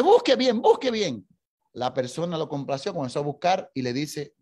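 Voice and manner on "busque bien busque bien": higher and louder repeated plea; insistent demand. Retold or imitated. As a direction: press the clerk once more. Isaac insists again.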